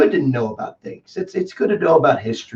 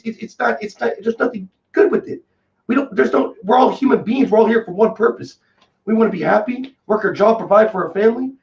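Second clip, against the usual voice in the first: normal talking voice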